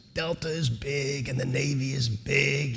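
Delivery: slow, soft, measured judge; judge's voice. Retold or imitated. Judge sizing up a job candidate, slow and soft. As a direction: impersonate a judge talking slowly and quietly. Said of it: "that's exactly how he talks".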